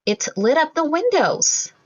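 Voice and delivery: ordinary delivery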